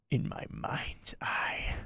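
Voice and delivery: slow, breathy menacing whisper; Darth Maul whisper. Acted. Streamer doing Maul for a super chat, menacing whisper. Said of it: "My Maul doing the Kenobi scream impression".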